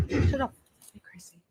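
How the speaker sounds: whispering to herself; whispered. Real, not acted. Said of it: "I think I might just".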